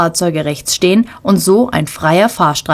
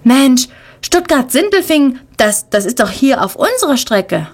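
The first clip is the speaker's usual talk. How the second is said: put-on higher, eager child voice; excited boy; 7 semitones higher than usual. A narrator's reading. Boy in the car, excited about the news, higher and eager. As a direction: blurt it out like an excited kid.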